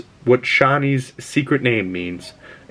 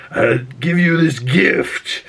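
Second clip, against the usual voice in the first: slow, raised Jabba-like drawl; Baron; 5 semitones higher, slower than usual. Retold or imitated. Reviewer mimicking the Baron in his bath, slow put-on villain voice. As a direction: imitate a big, lazy villain dragging out the words. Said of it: "the way he talks".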